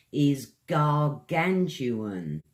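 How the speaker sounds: gargantuan drawn out slowly and stressed; slow emphasis. Shown as a demonstration. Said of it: "the advanced word I like to use".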